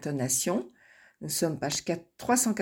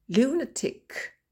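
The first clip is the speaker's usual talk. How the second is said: slow lunatic, high on LU; stress on LU; 6 semitones higher, slower than usual. Shown as a demonstration. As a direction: hit LU in lunatic. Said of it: "Les exceptions". (accent tonique sur LU dans lunatic)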